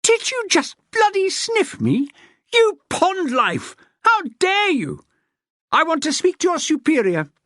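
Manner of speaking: shrieked. A narrator's reading. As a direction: shriek it, appalled. Continued shrieking rant.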